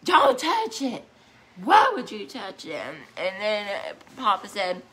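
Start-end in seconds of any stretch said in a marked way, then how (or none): 0.0-2.8 scolding mom. Retold or imitated. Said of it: "And she said".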